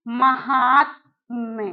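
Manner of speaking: महात्म्य said slowly, every syllable drawn out; slow careful spelling-out. Shown as a demonstration. Teacher to students, slow careful pronunciation. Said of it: "ये आपका सही है".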